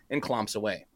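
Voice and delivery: usual speaking voice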